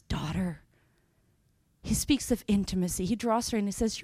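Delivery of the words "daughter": tender whisper. Acted. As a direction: breathe "Daughter." like a loving father. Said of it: "Jesus so tenderly looks at her and says".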